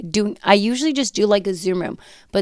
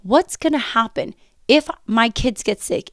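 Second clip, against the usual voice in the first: normal talking voice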